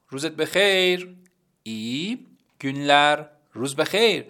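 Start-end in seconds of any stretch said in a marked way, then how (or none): 1.6-3.2 ای گونلر drawn out very slowly; slow Turkish demo. Shown as a demonstration.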